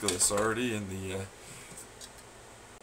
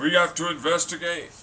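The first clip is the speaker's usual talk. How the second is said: raised, louder mocking government voice; mock official voice; 4 semitones higher, 6 dB louder than usual. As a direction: parrot the agents pompously. Mocking the hackers' official line.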